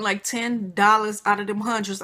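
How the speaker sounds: ordinary delivery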